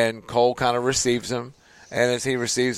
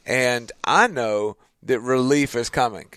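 ordinary talk, nothing marked

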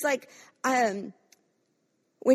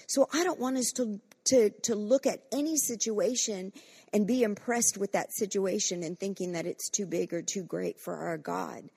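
normal talking voice

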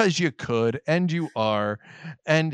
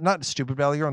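usual speaking voice

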